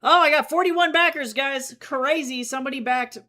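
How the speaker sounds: loud, high thrilled announcement; loud delighted cry; excited. Real, not acted. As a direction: burst out with the good news. Reacts to backer count.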